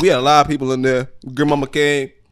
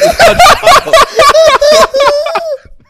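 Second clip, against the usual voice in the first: loud, very high laughter; screaming laugh; 11 dB louder than usual. Real, not acted. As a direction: howl with laughter. Laughs at own embarrassing story.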